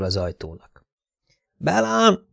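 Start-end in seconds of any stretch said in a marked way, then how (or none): 1.6-2.3 high, drawn-out call of a name; shut-in captive calling. A narrator's reading. Szelin calls to her captor from the locked bathroom, high-voiced. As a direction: call high from behind a door. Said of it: "szólt Szelim bentről".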